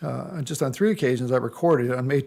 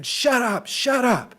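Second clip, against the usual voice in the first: imitating a neighbor screaming, pitched way up; retold screaming; 9 semitones higher, slower than usual. Neighbor screaming at the speaker's wife over a leash, high retold yell. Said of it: "she started screaming at my wife".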